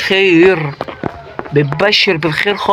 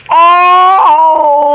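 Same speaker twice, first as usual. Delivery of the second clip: startled shout; 11 semitones higher, 7 dB louder, much slower than usual. Real, not acted. Sudden danger in game.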